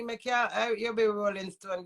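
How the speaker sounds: usual speaking voice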